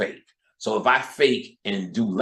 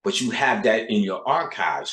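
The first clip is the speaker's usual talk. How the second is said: normal talking voice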